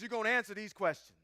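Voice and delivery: ordinary talk, nothing marked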